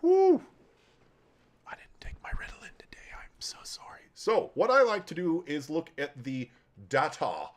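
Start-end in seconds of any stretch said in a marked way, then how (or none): 1.7-4.7 whisper. Real, not acted.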